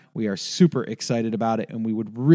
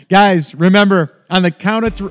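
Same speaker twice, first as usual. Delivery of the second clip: loud rallying call; 6 semitones higher, 10 dB louder than usual. Real, not acted. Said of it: "No, no, not on the count of three".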